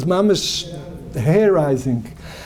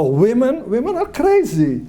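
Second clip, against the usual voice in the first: put-on quoting a dismissive young student, pitched up high on crazy; mock scornful complaint; 6 semitones higher than usual. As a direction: imitate the boy's scorn, rising high on crazy. Mimicking a young man's complaint.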